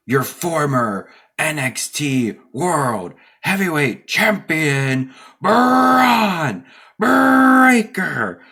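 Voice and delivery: slow booming announcer, rising high; Paul Heyman intro. Retold or imitated. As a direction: stretch the name out like a ring hype man. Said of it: "Paul did the whole".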